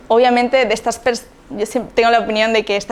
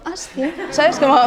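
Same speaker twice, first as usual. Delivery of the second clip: polite listener reply; 6 semitones higher than usual. Acted. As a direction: acknowledge it lightly without committing. Feigned polite reply to awkward remark. (respuesta cortés, suave y aguda)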